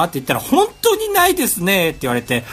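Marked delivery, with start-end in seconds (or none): 0.4-1.9 high, polite staff voice answering the phone; a phone clerk politely saying no; high-pitched customer service voice; company staff voice. Retold or imitated. Quoting the company staff's reply.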